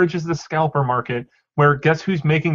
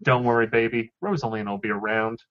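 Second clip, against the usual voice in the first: soft, low mock-comforting tone; playful reassurance, trailing softer; soothing, reassuring; 5 semitones lower, 5 dB quieter than usual. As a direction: soothe them tenderly, dropping lower and softer. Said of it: "where is the soothing, reassuring tone".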